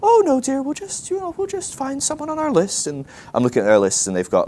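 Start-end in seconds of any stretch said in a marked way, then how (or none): 0.0-2.9 put-on very high, sweet-talking old dear; hammed-up dithering old lady falsetto. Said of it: "I'm talking to these ladies".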